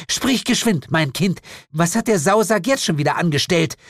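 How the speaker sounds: high, urgent witch queen; impatient demon mother. Acted. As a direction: demand news crossly from your child.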